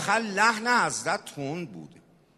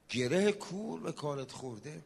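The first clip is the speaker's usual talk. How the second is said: soft, lower-pitched preacher's question; hushed refrain; 3 semitones lower, 9 dB quieter than usual. Real, not acted. Preacher returning to the question after a verse, hushed. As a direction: drop right down and ask it gently.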